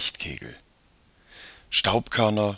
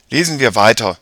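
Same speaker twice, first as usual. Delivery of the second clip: louder, decisive suggestion; boy urging on; 8 dB louder than usual. A narrator's reading. Jakob suggests they read on, decisive. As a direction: propose it firmly to your sister.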